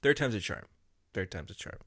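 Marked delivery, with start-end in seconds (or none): 1.1-1.8 much softer, pulled back. Shown as a demonstration. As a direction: say it quietly, away from mic. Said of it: "so that way I'll be softer this time".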